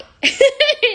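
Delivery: sudden very high laugh; high shrieking laugh. Real, not acted. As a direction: let a big laugh burst out. Laugh after ranting questions.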